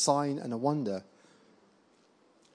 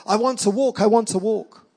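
higher, urgent voice of a woman; raised pitch, insistent repeat; retold sudden cry; 8 semitones higher, 5 dB louder than usual. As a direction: imitate her sudden eager outburst.

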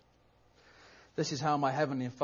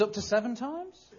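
rising, higher, hesitant offer; tentative guess; 8 semitones higher than usual. Acted. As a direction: venture it like an unsure bid. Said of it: "he ventures a guess".

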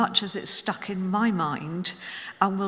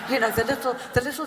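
laughing; 4 semitones higher, much faster than usual. Real, not acted. Laughing while telling.

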